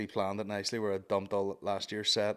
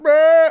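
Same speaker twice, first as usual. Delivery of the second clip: high, loud mock heckle; heckler's noise; 17 dB louder than usual. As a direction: blurt a drawn-out heckling noise. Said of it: "someone went".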